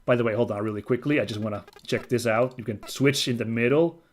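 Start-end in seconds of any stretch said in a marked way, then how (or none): none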